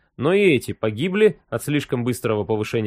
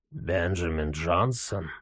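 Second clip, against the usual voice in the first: low, quiet, slow toothless mumble; mumbling old man; 9 semitones lower, 8 dB quieter, slower than usual. Acted. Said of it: "прошамкал старик".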